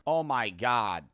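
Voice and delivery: normal talking voice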